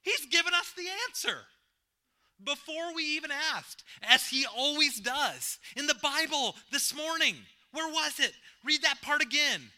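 1 excited. A narrator's reading. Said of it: "she said excitedly".

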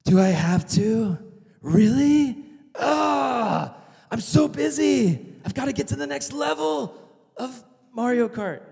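whining, stretched-out complaint; whiny teenager. Retold or imitated. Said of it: "this rebellious nature where it's like".

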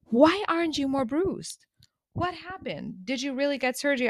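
high, surprised grandma questions. Retold or imitated. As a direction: lightly voice a worried grandma. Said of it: "And she was like".

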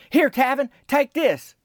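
high, eager Cheryl calling out Tavin's name; Cheryl's voice. Retold or imitated. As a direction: lift the voice up high to play a keen Cheryl. Narrator voices Cheryl offering cup.

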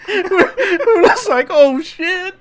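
very high, loud and slow through laughter; cracking up. Real, not acted. Storyteller recalling the staff's shocked reaction, cracking up. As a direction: barely get it out for laughing. Laughing too hard to speak.

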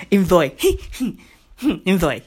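low, giggly sign-off; giggling. Real, not acted. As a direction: chuckle through a short send-off. Giggles while introducing his clip.

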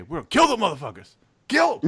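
rising into a full battle cry; pep talk yell. Retold or imitated. Hyping his team before the stock exchange, escalating shout. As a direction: fire up the troops like going to war. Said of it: "the pep talk".

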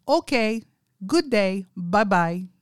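put-on loud Indian accent, brief phrases. Caller ending the phone call, Indian accent. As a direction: sign off like the imitated Indian caller. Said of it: "he has a very strong Indian accent".

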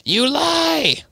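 high shrieking accusation; furious outburst. Acted. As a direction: spit it out in a rage.

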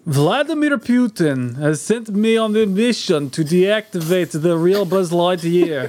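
deliberate Russian toy-villain delivery; Russian accent. Acted. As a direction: put on a measured Russian accent. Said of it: "So I'm a Russian".

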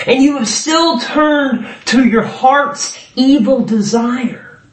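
high, emphatic reproach voiced as God; preacher declaiming God's grievance; God's wounded appeal. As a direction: demand an answer from those who turned away. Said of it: "And he is saying in verse three".

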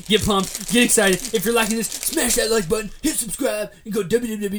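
loud, high hype pitch; energy drink ad. Pretend. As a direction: pump up the viewers like an ad. Mock hype YouTube outro.

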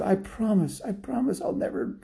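very high, softened pleading; pleading promise. Acted. Lady on a leash promising the dog, high pleading. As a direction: beg in a small high voice.

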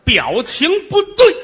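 loud, high, slow 表情不对; 大声慢慢地说; mock reply. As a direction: bark the punchline slowly. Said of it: "你告诉他".

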